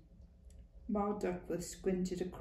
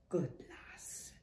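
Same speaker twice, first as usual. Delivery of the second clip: a soft whispered "good lass"; whispered approval; 3 semitones lower, 7 dB quieter than usual. Acted. Old woman softly praising Clara, whispered. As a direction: breathe it like a pleased old nurse.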